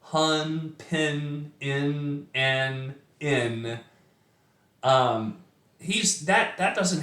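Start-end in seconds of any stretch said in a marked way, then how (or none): 0.0-3.8 "Hun", "pin", "in", "an", "in" said as separate clipped syllables; sounds isolated. Shown as a demonstration. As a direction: pick out each in sound one by one. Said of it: "this repetition of sound".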